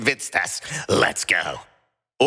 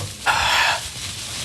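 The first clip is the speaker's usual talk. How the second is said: tired sigh. Acted. Kraglin back from Walmart.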